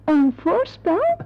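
slow, drawn-out disbelieving echo; startled echo. Acted. Receptionist echoing the visitor's slip in slow disbelief. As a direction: repeat it back slowly as if you misheard.

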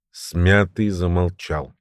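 ordinary delivery

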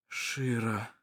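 a faint, breathless whisper. A narrator's reading. Vassar whispering Shira's name in dread. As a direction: barely breathe her name. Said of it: "прошептал Вассар".

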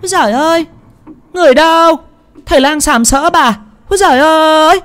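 high shrieking woman's cry for help. Acted. Third wife framing the healer, calling for help in a high shriek. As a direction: shriek it out high and loud, like she's the victim. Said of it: "Bà hô hoán".

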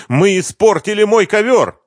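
high, loud cry of dismay; cursing. A narrator's reading. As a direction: exclaim as if swearing, pitched high. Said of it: "вскочил с проклятием".

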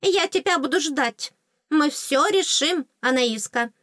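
high fairy voice with a touch of grumble; grumbly fairy. A narrator's reading. Fairy reassures departing heroine, mild high grumble. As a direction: promise help, grumbling lightly. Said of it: "ворчливо добавила".

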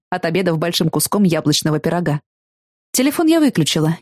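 usual speaking voice

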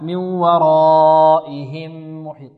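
slow chant holding the long a of ورائهم four beats; four-count madd. Shown as a demonstration. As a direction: stretch "raa" in ورائهم before the hamza. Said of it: "أربع حركات". (مد متصل أربع حركات في ورائهم)

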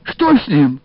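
high, loud exclamation of alarm; alarmed cry. A narrator's reading. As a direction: cry out in alarm, pitch jumping up. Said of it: "вскричала Бабале". (встревоженный вскрик)

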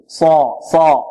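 ص in صا dark and full; heavy sad. Shown as a demonstration. Teacher models the elevated letter, heavy sad. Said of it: "نفخمها".

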